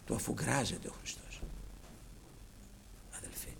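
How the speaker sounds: whispered, low and soft; hushed. Acted. Preacher to congregation about Christ listening, hushed whisper. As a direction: lean in and whisper it. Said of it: "preacher drops to whisper".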